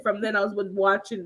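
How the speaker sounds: usual speaking voice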